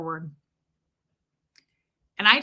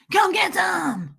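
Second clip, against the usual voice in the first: high, slow, drawn-out mock war cry; menacing challenge; 10 semitones higher, 4 dB louder, slower than usual. Woman imagining facing down the rude passenger, mock menacing howl. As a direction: howl it as an over-the-top threat.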